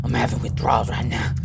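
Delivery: hushed, breathy, desperate whisper; a pretend strung-out whisper; mock whisper. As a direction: whisper it like a hammed-up junkie. Acting out a drug craving.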